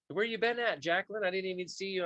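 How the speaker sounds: excited. Real, not acted. Calling out to a viewer.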